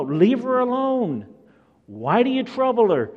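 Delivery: high, loud indignant challenge; Jesus rebuking. Acted. Preacher as Jesus facing the disciples, loud high rebuke. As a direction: raise the voice to defend her. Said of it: "Jesus steps in between and says".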